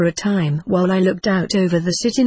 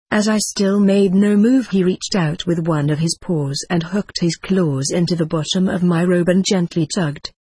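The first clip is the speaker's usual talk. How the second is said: ordinary delivery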